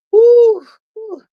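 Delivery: a loud, high "woo, woo" to blow off stress; loud woo exhale. Real, not acted. Host blowing off stress on stream, loud woo. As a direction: huff out "woo" twice to steady herself.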